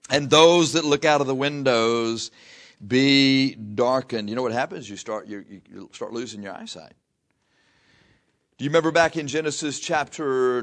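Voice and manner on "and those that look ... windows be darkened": slow, loud, raised verse reading; preacher intoning scripture; preaching scripture. Acted. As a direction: proclaim the verse slowly.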